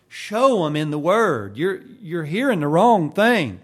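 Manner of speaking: loud preacher's outburst; urgent exhortation. Real, not acted. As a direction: raise your voice hard and urge the congregation. Preacher urging congregation to evangelize.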